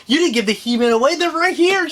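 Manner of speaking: quick protest climbing high; retold protest. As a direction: act out a rushed, incredulous objection. Retold protest at seeing the He-Man.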